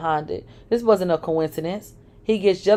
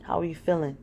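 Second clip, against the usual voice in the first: soft, quiet concern; 7 dB quieter than usual. Acted. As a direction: ask gently, worried. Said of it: "Sean asked with concern in his voice".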